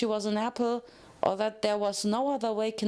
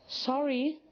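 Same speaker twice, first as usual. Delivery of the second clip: high, small apology; sheepish Pinkie Pie; 4 semitones higher than usual. A narrator's reading. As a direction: say it meekly and high.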